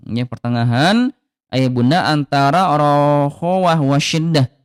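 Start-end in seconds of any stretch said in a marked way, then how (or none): none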